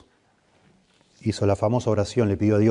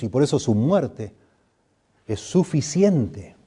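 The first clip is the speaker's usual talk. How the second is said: higher, building pulpit preaching; 3 semitones higher than usual. Acted. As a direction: drive the point home to the congregation. Preaching climax, pitch climbs.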